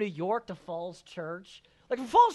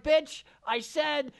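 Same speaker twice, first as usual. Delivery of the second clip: slow, high, louder mock outrage; retold indignation; 11 semitones higher, 5 dB louder, much slower than usual. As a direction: snap back slowly and indignantly. Retold quarrel with the barber.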